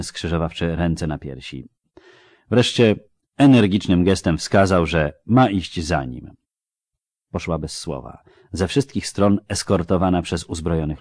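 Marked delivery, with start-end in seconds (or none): none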